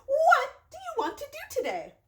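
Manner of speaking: excited Piggie voice. Acted. Reading Piggie's voice to children.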